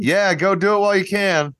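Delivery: high-pitched, eager encouragement; kid's earnest response. Retold or imitated. Kid answering an old man, earnest and high-pitched. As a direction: imitate an earnest kid cheering him on. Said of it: "that kid's genuine response".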